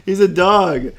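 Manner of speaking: laughing through the words. Real, not acted. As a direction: crack up mid-sentence. Laughing defense of dog.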